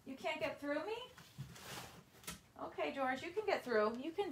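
high, soft cooing to the dog; pet talk. Real, not acted. Owner coaxing George past a box, soft and high.

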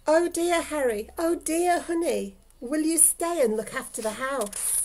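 high, fretful plea; anxious old lady's high voice; worried old lady. Acted. Old lady fretting to her dogs.

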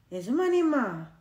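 a lightly voiced wailing boy; wailing cry. A narrator's reading. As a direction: wail the words out. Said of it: "ஓலமிட்டு கூவினான்".

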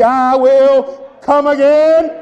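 high, loud preacher's call, rising; call and response. Real, not acted. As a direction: lead the church in shouting praise. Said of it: "Would you say it with me".

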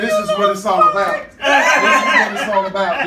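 high, loud excited burst. Real, not acted. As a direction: blurt it out high and fast. Excited proposal climax.

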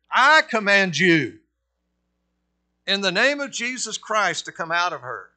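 booming command in Jesus' name; commanding shout. Acted. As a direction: thunder it like Paul casting out a spirit. Paul commanding the spirit.

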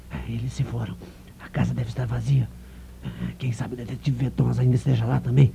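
sneaky hush. Acted. Journalist sneaking up on the empty house, hushed. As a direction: keep it down, sneaking in.

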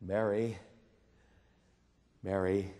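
soft and low, saying "Mary" twice gently; Jesus gently calling Mary's name; tender call. Acted. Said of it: "And he said to her".

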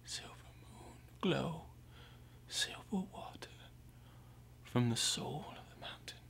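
soft, half-whispered and spaced out; hushed invocation. Acted. Man calls on moon and mountain water, hushed invocation. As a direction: breathe it out as a reverent near-whisper.

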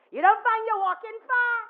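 loud, high imitation of the man; loud query. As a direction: shout the question across. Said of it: "he never ever once talked softly".

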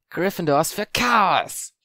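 put-on triumphant girl's slow proclamation; grinning Parvati. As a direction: savour each word with a grin. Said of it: "Mit einem breiten Grinsen".

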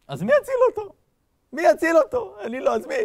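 high, loud pleading demand; frantic protest. Retold or imitated. Angel asking God who will save Abraham, frantic protest. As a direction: cry out in agitated disbelief.

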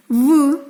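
"В" voiced alone as a sound; isolated consonant. Shown as a demonstration. Teacher giving the word's first sound, "В" said on its own. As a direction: sound out "В" by itself, not its letter name. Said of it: "перший звук у слові ворона".

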